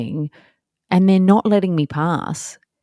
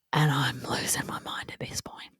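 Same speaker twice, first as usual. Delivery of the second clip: much softer breathy aside; whisper; 12 dB quieter than usual. Real, not acted. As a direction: confide your exasperation under your breath. Exasperated aside to audience.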